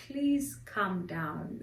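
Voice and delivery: lightly voiced doctor, slow and measured; doctor calming a patient. Doctor to the wailing mother, slow and soothing. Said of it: "And the doctor's like".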